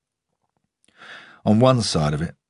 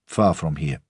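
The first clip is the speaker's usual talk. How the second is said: put-on old groundsman, lightly Russian-accented. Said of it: "The accent was Russian".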